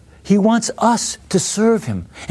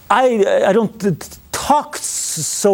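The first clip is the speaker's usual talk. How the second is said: halting mock stammer on t- and s-; stuttering; 2 semitones higher, slower than usual. Moses objecting to God, lightly acted stutter. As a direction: trip over the words like a stammerer. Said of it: "Moses had a stutter".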